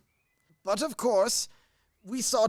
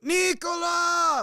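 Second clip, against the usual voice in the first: shouting for servant; 11 semitones higher, slower than usual. Acted. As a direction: bellow the servant's name. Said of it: "Oh, don't shout".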